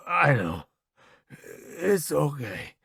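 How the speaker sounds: very slow, weak reassurance; drawn-out, fading acceptance; resigned. A narrator's reading. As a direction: comfort a friend while dying. Dying soldier accepts his fate.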